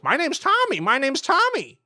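very high parrot echo; high squawked mimicry; mimicking parrot. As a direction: repeat it like a parrot. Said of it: "parrots copy what they're saying".

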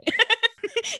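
high laughing burst; high burst of laughter. Real, not acted. Laughing at her own [name] joke, high burst. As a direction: crack up high.

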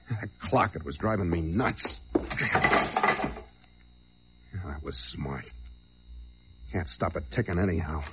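low, quiet noir narration; noir detective. Acted. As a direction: brood over the memory quietly. Quiet hard-boiled flashback narration.

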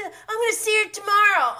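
high, loud, rushed mimicry; mocking eagerness. Recapper aping the man's quick answer, mocking. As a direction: parrot him in an over-eager, high-pitched rush.

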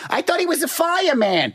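high mock old lady; older ladies confused. Imitating older ladies at the complex, high and puzzled. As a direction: squeak it out like a puzzled old woman. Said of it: "They're like".

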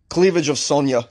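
hammed-up bad Russian accent; joky Russian-accented toast; terrible Russian accent. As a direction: put on a silly Russian voice. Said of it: "how terrible my Russian accent is".

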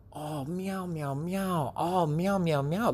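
high, soft little meows; cat meows. Retold or imitated. Storyteller meows softly as the shaved cats. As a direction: meow softly like a pleased cat. Teller imitates cats meowing.

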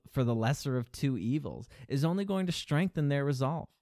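ordinary talk, nothing marked

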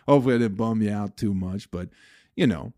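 normal talking voice

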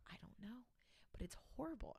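hushed, breathless roommate whisper. Retold or imitated. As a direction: whisper it like it's a secret horror. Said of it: "And she's like".

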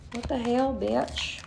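soft, muttered to herself; quiet mutter. Real, not acted. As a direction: murmur it under your breath. Reacting to her own nakedness.

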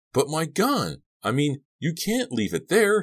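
very high, loud panicked outburst; screaming. A narrator's reading. Boy frantic over his gun, screaming at a girl. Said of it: "he screamed at Della".

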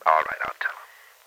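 slow whispered giving in; barely voiced, slow; slow whispered promise. Giving in on the phone.

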